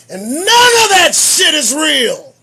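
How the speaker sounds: loud, high shouted rant; furious loud outburst. Real, not acted. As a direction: yell it at the audience. Ranting about memorials.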